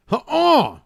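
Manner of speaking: sudden high yelp at the roll; surprised yelp. Real, not acted. Gamer yelping as the Yahtzee roll fails. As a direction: yelp as the dice betray you.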